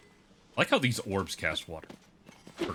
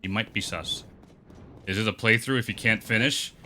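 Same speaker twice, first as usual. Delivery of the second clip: ordinary talk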